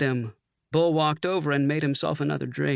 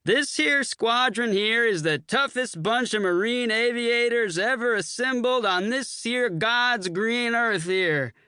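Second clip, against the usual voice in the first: bellowing; 9 semitones higher than usual. A narrator's reading. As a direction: boom it out proudly. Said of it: "Sammy bellowed".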